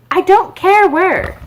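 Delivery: high, slow, loud Alice. A narrator's reading. Alice answering the cat, high and slow. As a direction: blurt it out up high. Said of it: "said Alice".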